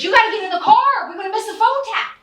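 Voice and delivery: put-on high and loud, eager kid hurrying parent; a kid begging to go, excited; excited kid urging. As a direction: play the kid who can't wait to leave. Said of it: "It was".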